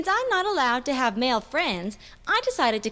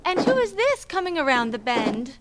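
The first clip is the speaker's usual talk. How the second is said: high melodramatic damsel in distress; hammy stage actor; 7 semitones higher than usual. Acted. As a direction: wail like a trapped princess. Princess role in play.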